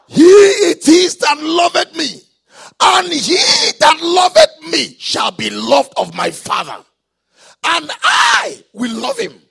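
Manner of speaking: high, very loud declamation of the verse; shouted scripture preaching. Real, not acted. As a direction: cry the verse out to the whole congregation.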